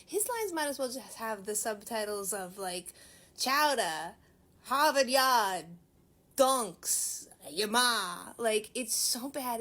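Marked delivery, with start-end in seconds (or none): 3.4-8.3 loud, hammed-up New England accent, slow and broad; mock a terrible New England accent, loudly; bad New England accent. Mocking an actor's awful New England accent, loud parody. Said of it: "did the worst New England accent ever".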